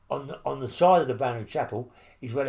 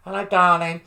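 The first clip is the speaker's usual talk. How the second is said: a cheeky, louder "darling" to wind up a guard; mock sweet greeting; 6 semitones higher, 10 dB louder than usual. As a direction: sing it out to tease the screw. Mocking the screws with a greeting.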